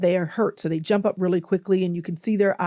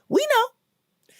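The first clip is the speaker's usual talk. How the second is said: fired up preaching. Real, not acted. To viewers about cussing people out in the car, fired up and preaching. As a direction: preach it to the congregation.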